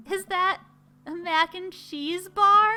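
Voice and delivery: high, slow wondering question; amazed daughter. Pretend. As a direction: marvel at the food as the daughter.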